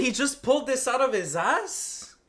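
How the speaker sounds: loud, high, drawn-out disbelief; incredulous question. Real, not acted. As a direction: repeat it slowly in astonishment. Repeats the clip in disbelief.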